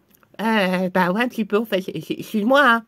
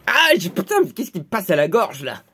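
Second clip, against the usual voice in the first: acted pain; 5 semitones lower than usual. Victim seized at the throat by magic, pained. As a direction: yelp falling into low complaint.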